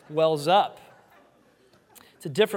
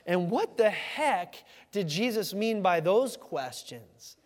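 very slow, drawn-out dazed question; stunned disbelief; 4 semitones higher, much slower than usual. Retold or imitated. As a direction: say it still in shock. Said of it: "They just are like".

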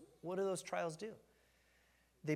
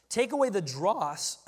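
voice cracking; 3 semitones higher, 9 dB louder than usual. Real, not acted. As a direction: voice cracks on a word. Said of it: "hear my voice crack just then".

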